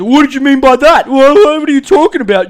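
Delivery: high, loud mock outrage; high hammed-up defensive yell; mock defensive husband. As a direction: squeal it like a touchy groom. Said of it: "he just goes".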